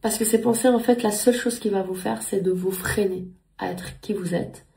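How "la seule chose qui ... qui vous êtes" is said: ordinary talk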